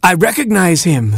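high exclamation, falling away; astonished recognition. Acted. Baboon recognising his long-dead son, high amazed cry. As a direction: cry out as you suddenly know him.

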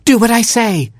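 high bossy robin call. Acted. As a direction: call it out to the bird.

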